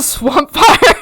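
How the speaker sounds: loud, high shout breaking into laughter; playful yell. Shown as a demonstration. Urge to yell while watching Ben Ten, playful shout. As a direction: yell it like a cartoon hero. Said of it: "I get the urge to yell".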